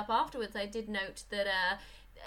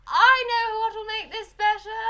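much higher, louder melodramatic voice; dramatic Enid; 11 semitones higher, 11 dB louder than usual. Retold or imitated. Enid acting out her plan, high and hammed-up. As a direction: swoon it like a grand society lady. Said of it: "She's just really, like".

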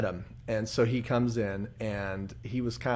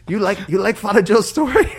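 loud, higher imitation of a Filipino accent; 8 semitones higher, 10 dB louder than usual. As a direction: ask it loudly in a Filipino accent. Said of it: "pretty good impression you do there".

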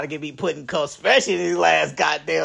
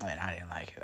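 whispered; 10 semitones lower, 15 dB quieter than usual. Real, not acted. Mom got pissed, scared.

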